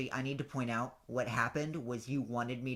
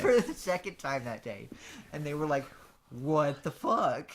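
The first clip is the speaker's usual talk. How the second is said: laughing; 3 semitones higher, 7 dB louder than usual. Real, not acted. Laughing retelling audience reaction.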